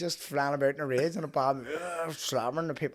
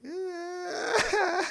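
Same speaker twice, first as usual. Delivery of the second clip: high wheezy put-on laugh; imitated War Dogs laugh; 4 dB louder than usual. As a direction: mimic a silly film laugh. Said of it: "know the wee laugh out of War Dogs".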